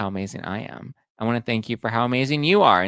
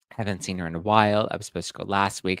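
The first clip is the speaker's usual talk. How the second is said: ordinary talk, nothing marked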